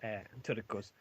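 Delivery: ordinary talk, nothing marked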